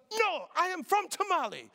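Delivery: high, loud insistent correction of Tamale; imitating the Ghanaian man. The Ghanaian stranger corrects the pastor, high and insistent.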